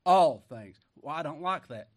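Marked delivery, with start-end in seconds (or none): 0.0-0.8 much louder, pounding "All things"; loud emphatic repetition. Real, not acted. Preacher pressing the congregation, pounding "All things". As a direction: drive home "All things".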